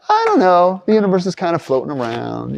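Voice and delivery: a hint of careless shrugging voice; mock casual shrug. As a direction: toss it off carelessly. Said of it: "It's not like".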